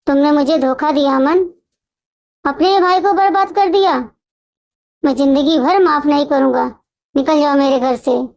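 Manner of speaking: plain reading voice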